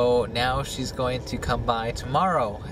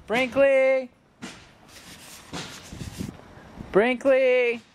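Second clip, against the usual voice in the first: calling the dog; 13 semitones higher, much slower than usual. Real, not acted. Searching yard calling the dog.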